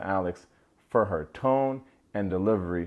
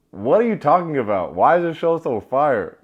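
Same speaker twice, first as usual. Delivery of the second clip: excited disbelief; 6 semitones higher, 8 dB louder than usual. Real, not acted. Reacting with disbelief.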